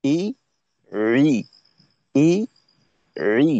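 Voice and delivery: রি pressed from mid-throat, ই light and plain; throat sound ain. Shown as a demonstration. Teacher showing the throat letter to a student, pressed throat sound. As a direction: squeeze রি from the middle of the throat. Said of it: "এভাবে এই চাপটা রাখতে হবে".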